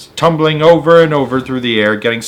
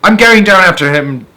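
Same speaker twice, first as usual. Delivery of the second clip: higher, louder determined call; determined cry; 7 semitones higher, 7 dB louder than usual. Acted. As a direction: announce you're diving after him. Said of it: "cried James".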